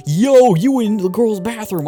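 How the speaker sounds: put-on high, loud, slow teasing kid voice; mocking eighth grader. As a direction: call him out like a cocky teen. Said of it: "an eighth grader went".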